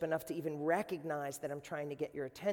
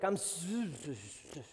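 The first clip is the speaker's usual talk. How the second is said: Fonz stammer. Retold or imitated. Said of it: "he'd always be like".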